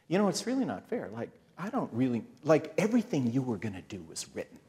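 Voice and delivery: soft, mild mock whine; understated, retold grumbling; whining. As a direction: complain to God in a small voice. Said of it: "and I'm whining".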